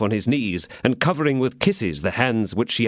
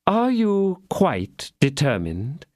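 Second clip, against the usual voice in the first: lingering doubt; 7 semitones higher, slower than usual. Acted. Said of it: "with a lingering doubt".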